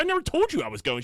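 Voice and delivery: high-pitched retold bickering; acting out an aggrieved son arguing back; indignant protest. Retold argument with mother.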